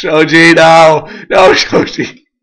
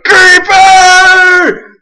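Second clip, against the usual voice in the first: high, loud, drawn-out warning scream; re-enacted scream; 10 semitones higher, 5 dB louder than usual. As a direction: imitate your own panicked shriek. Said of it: "I'm like".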